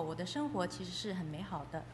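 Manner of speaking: ordinary talk, nothing marked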